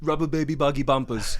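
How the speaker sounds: Schwarzenegger-style Austrian voice; Arnold impression. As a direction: bark it out like Arnold. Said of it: "Can I do Arnold?".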